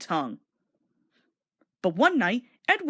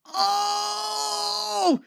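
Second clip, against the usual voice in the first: drawn-out high cry; long high groan; 12 semitones higher than usual. A narrator's reading. Thomas skidding on the rails, long high groan. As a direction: groan out as the brakes lock. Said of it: "groaned Thomas".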